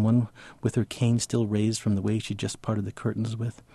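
ordinary talk

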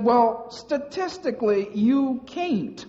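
a mock Southern drawl, high-voiced; Southern accent. Instructor telling him he can't, in a mock Southern accent. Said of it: "she said in her southern accent".

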